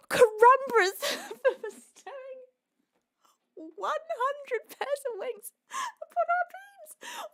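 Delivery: high, slow, disbelieving squeak; tearful astonishment. Real, not acted. Streamer reading a gift of one hundred, tearfully astonished. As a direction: draw it out, high and choked with tears.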